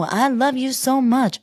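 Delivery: high, slow put-on reality-star voice; high and drawn-out, gushing; Huda impression. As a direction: gush it in her high islander voice. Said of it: "She didn't say".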